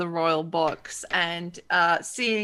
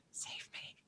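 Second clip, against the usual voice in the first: breathless unvoiced whisper of "Save me"; whispered plea; 25 dB quieter than usual. Fariha silently begs the players for rescue, whispered. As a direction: mouth a desperate plea under the breath.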